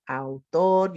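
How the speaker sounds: autor split into two beats, au-tor; Spanish syllable by syllable. Shown as a demonstration. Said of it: "How many syllables are in that Spanish word?".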